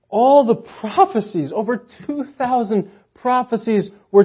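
loud, rising sermon build; fervent preaching. Real, not acted. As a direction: preach it to the room with conviction. Preaching at full force.

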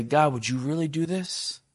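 gentle intimate question; intimate quiet ask. Shown as a demonstration. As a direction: lean in and ask softly. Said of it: "draw near and get close and say".

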